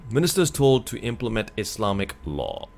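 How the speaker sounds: reading the headline aloud; news article read. Acted. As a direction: read it out like a report.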